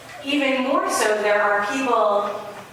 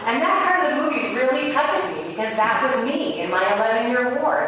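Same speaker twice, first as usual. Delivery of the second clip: normal talking voice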